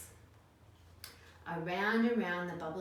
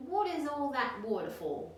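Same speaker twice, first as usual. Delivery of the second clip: higher, louder curious question; curious platypus; 8 semitones higher, 5 dB louder than usual. Acted. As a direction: ask it like a nosy platypus. Platypus asks Dingo.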